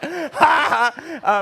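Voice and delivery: loud, high imitated guffaw; hammed-up trumpeting laugh; elephant laugh. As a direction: burst out as if unable to hold it in. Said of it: "elephant laugh".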